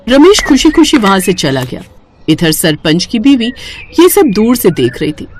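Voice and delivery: plain reading voice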